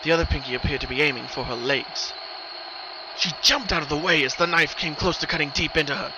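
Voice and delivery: ordinary delivery, nothing marked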